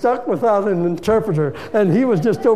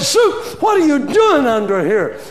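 high, loud shout of the name; calling out in astonishment; a shouted surprised question; 9 semitones higher, 6 dB louder than usual. Retold or imitated. Finding the weeping interpreter.

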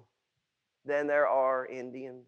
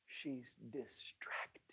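soft whispered aside; 4 semitones lower, 19 dB quieter, slower than usual. Acted. Preacher hushing the church about Martha, whispered. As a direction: breathe it like a secret.

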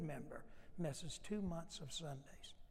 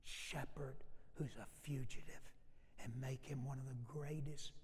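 God's gentle whisper; 4 semitones lower, 8 dB quieter than usual. Retold or imitated. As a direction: whisper it like a tender promise. God answers Moses softly.

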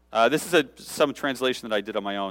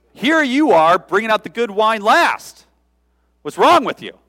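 higher and louder, slowed indignant rebuke; indignant scolding; 10 semitones higher, 10 dB louder than usual. Pretend. Head steward scolding the groom, higher and louder. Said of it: "condescending tone of voice".

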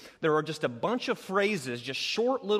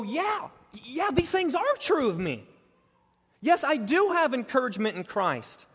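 high, eager affirming; eager agreement; 9 semitones higher, 4 dB louder than usual. Retold or imitated. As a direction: agree gladly, with rising joy. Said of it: "hear these things and go".

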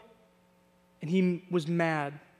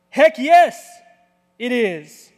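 angry Jonah shout; 3 semitones higher, 9 dB louder, slower than usual. Acted. Jonah snaps back at God, loud and angry. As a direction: bark it back like a fed-up prophet. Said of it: "That's what he says".

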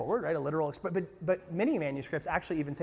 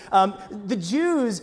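ordinary talk

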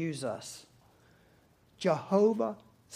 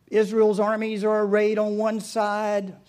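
ordinary talk

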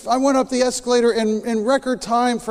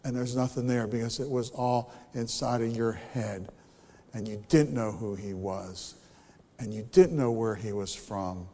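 hushed, grave sermon voice; hushed preaching; 9 semitones lower, 7 dB quieter than usual. Real, not acted. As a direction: drop to a grave hush. Preacher drops to hush.

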